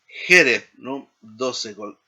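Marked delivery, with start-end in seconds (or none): 0.0-0.7 loud opening "Je" in "Jerez"; first-syllable stress. Shown as a demonstration. Teacher listing segolate examples, first-syllable stress on Jerez. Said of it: "en la primera sílaba".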